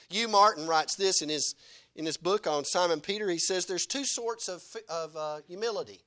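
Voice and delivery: ordinary talk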